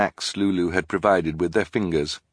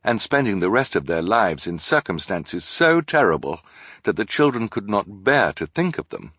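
ordinary delivery

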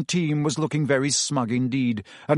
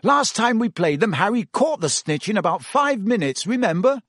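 louder, higher, cocky teasing; breezy twin; 7 semitones higher, 3 dB louder than usual. A narrator's reading. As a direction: wave away the captain's worries. Fred voiced, breezy.

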